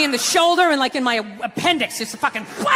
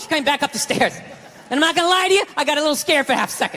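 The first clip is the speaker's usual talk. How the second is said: ordinary talk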